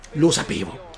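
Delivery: low resigned mutter. Real, not acted. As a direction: mutter it to yourself. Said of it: "repeated, muttered".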